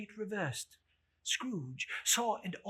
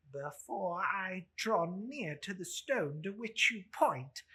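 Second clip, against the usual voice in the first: fearful Scrooge; 4 semitones higher, 6 dB louder than usual. Acted. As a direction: ask it dreading the answer. Scrooge asks his question.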